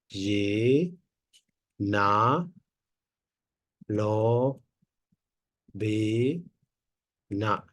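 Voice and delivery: Ye, na, lo, be, na with a pause after each syllable. Shown as a demonstration. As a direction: split it into single syllables, one beat each. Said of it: "I make a slash".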